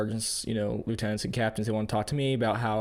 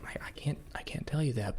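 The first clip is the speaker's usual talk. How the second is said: whispered, can't tell you that; hushed reply; 2 semitones higher, 8 dB quieter than usual. Retold or imitated. Field trainer hushing a nervous recruit, whispered. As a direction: keep it hushed and secret-sounding.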